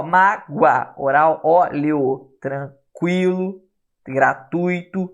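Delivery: slow, stretched syllables in MÁGOA, ÓLEO, TRANQUILO, GRATUITO; oral-sound demo. Shown as a demonstration. Teacher testing oral syllables with a held nose, slow oral-sound demo. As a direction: sound out each word slowly with the nose held. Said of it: "mas normalmente é oral".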